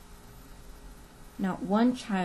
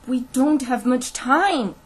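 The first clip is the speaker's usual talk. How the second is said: slow, loud, higher-pitched urging; urgent plea; 4 semitones higher, 8 dB louder, slower than usual. A narrator's reading. Teacher urges the sheriff to act, pleading urgently. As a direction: press hard for help. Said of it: "urged Catherine".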